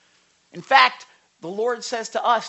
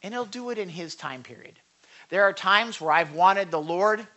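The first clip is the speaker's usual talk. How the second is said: ordinary talk, nothing marked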